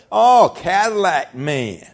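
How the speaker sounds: slow, drawn-out sneer of Cadillac man; mock them as they drawl it; taunting churchgoer; mocking church folk. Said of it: "some people would say".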